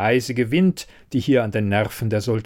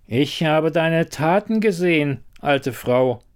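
lightly voiced king, higher and insistent; contradicting king; 5 semitones higher than usual. A narrator's reading. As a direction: push back at the old woman, pitched up. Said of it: "widersprach der König".

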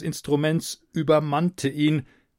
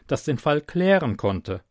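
usual speaking voice

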